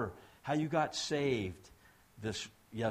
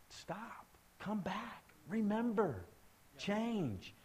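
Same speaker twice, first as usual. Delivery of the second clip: soft, higher-pitched urging, one word at a time; hushed plea; 8 semitones higher, 5 dB quieter, much slower than usual. Real, not acted. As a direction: plead gently, pausing between words. Preacher's hushed plea to backsliders.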